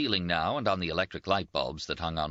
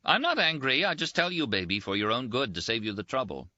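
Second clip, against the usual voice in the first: lightly voiced Rinaldi, a bit higher and teasing; teasing Italian friend; 7 semitones higher than usual. Rinaldi teases his wounded roommate, lightly voiced higher banter. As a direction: toast him with mocking cheer.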